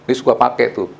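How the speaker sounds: usual speaking voice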